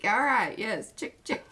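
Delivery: ordinary delivery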